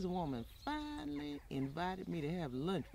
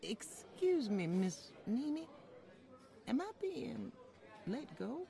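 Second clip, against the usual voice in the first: a maid nervously asking her employer if she is being let go; anxious worry; 7 semitones higher than usual. Acted. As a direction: ask it dreading the answer. Maid fears being dismissed.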